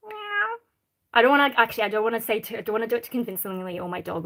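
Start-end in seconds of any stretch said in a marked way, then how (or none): 0.1-0.6 soft cat meow. Pretend. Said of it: "say meow".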